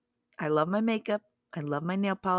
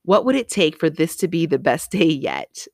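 full-voiced affirmation; proclaimed affirmation; 8 dB louder than usual. Shown as a demonstration. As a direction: say it out loud, louder and with gusto. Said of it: "That's my affirmation every morning".

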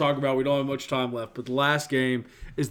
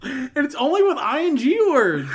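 higher, louder words through laughter; laughing; 11 semitones higher, 7 dB louder than usual. Real, not acted. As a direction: still giggling while explaining. Both laughing.

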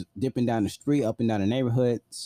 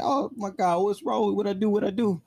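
higher, imitating tearful remorse; retold crying; 9 semitones higher than usual. Running away in tears, mock tearful pleading. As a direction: replay a sobbing apology, pitched up. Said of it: "Tears streaming down my face".

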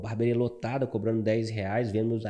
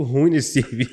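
chuckling mid-sentence, a bit louder; laughing through words; 7 dB louder than usual. Retold or imitated. Retelling his own ironic reply.

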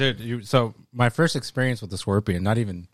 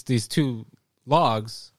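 ordinary delivery

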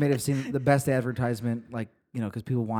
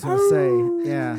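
howling voice; 8 dB louder, much slower than usual. Acted. Howling along with host.

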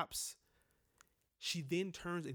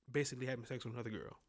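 normal talking voice

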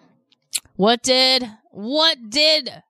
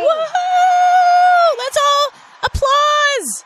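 high, loud whooping cheer; excited cheering; 5 dB louder, much slower than usual. Real, not acted. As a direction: whoop it up for the crowd. Cheering the groundhog on air.

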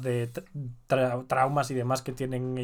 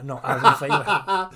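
laughing; 2 semitones higher, 7 dB louder, slower than usual. Real, not acted. Laughs at own name mix-up.

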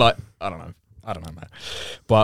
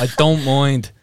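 put-on Irish accent, louder and higher; 4 semitones higher, 5 dB louder, much slower than usual. Shown as a demonstration. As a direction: try an Irish "don't mind". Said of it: "do an Irish accent with a lisp".

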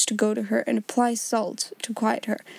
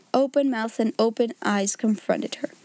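usual speaking voice

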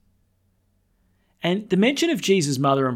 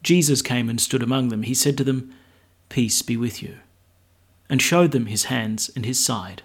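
louder formal gospel reading; gospel proclamation; 5 dB louder than usual. Acted.

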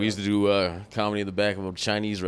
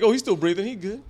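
lightly voiced, higher unbothered parent; mock parent; 10 semitones higher than usual. Joking about the parents, lightly voiced, higher unbothered parent. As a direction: toss it off like a parent who isn't worried. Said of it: "It's like".

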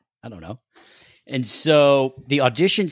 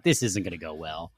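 resigned aside; 8 semitones lower, 9 dB quieter than usual. Retold or imitated. Dreading audition after rival's success.

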